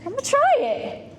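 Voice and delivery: put-on a naive girl giving in with delight; eager Eve. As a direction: Eve agreeing to taste the fruit, high and giddy. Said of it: "she's like".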